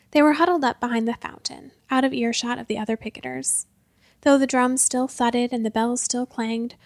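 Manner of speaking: plain reading voice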